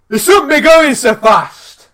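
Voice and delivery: high, loud, drawn-out telling-off; mock indignant holler; retold indignant shout. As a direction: yell it slowly as an affronted pedestrian. Woman's retort acted out, loud.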